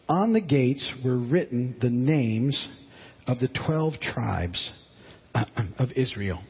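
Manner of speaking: slow, measured Bible reading; slow solemn reading of Revelation; scripture reading. Acted. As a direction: read it out word by word like a lesson from the pulpit.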